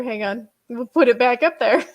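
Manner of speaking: ordinary talk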